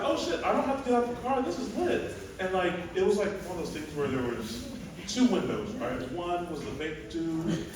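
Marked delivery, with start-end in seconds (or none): 0.0-2.1 high-pitched, thrilled exclamation; retold excitement. Comedian retelling a drive-through find, excited. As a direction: relive the happy discovery in a much higher voice.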